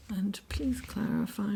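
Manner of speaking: soft breathy whisper to the cards; whispered request. Real, not acted. Reader whispers to the tarot deck, asking gently.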